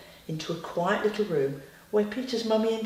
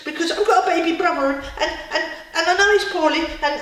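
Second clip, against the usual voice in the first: high, loud, stammering rush of excuses; worked-up little character tripping over words; gabbling flustered Angry; 7 semitones higher, 6 dB louder than usual. A narrator's reading. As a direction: blurt it out in a high, tumbling panic. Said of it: "Slow down, slow down".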